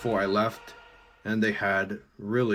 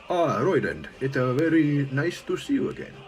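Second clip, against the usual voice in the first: higher pitched, mock Korean accent for Han; 6 semitones higher, 4 dB louder than usual. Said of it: "that's how he's supposed to talk".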